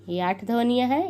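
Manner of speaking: ordinary talk, nothing marked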